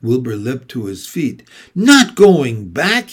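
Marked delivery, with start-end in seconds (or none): 1.8-3.1 higher, louder cry of disbelief; slow, loud, incredulous outcry; dismayed cry. A narrator's reading. Wilbur questions Charlotte about not going back, crying out. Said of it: "he cried".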